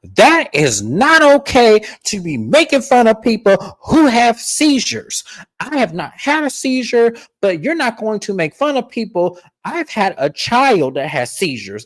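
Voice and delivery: high, slow, whiny mock of Meredith. Podcaster mocking Meredith's speech at dinner, high slow whine. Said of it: "And here come Meredith".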